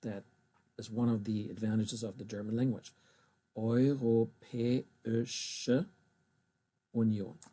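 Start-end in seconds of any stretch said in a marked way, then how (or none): 3.6-7.4 "Europäische" drawn out slowly with "eu" as oi, then "Union" apart with a falling tune; slow separated syllables. Shown as a demonstration. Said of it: "here you have that sh sound".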